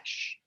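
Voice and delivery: quick hushed shh; soft voiceless shush. Shown as a demonstration. Showing how family quiets a child, gentle shush. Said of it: "Like that".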